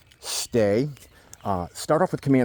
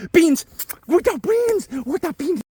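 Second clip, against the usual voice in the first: high excited shout; 4 dB louder, slower than usual. Real, not acted. Release command shouted excitedly.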